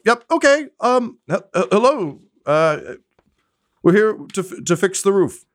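high, stammering workman; dangling worker awkwardly greeting the steward; flustered worker. Acted. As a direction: stumble through the greeting, caught off guard.

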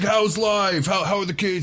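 high-pitched, hammed-up old-buddy greeting; hearty catching up. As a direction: play the delighted old pal.

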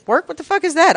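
high, loud, scoffing question; playful fake outrage; mock disbelief. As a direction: scoff at the very idea of work. Mocking her own dislike of work.

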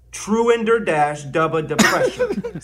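ordinary talk

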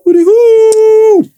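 high, loud, drawn-out hoot; hooty hoo call. Real, not acted. Show catchphrase call.

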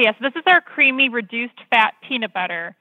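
ordinary talk

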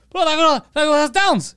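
high, loud mock outburst; imitated screaming. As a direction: imitate a friend suddenly yelling in shock. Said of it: "He just starts screaming".

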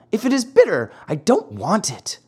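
lightly voiced whiny Pinocchio; a touch of childish whine; balky puppet child. A narrator's reading. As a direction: refuse like a fussy child, starting high. Pinocchio refusing his medicine.

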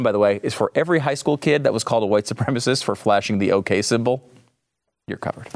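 ordinary talk

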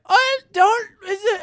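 high, alarmed warning; worried. Retold or imitated. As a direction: gasp it out in alarm. Quoting worried onlookers.

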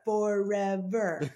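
long drawn-out movie-quote delivery; Sandlot voice. Acted. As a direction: stretch it out slowly like The Sandlot. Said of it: "nod to the Sandlot in that voice".

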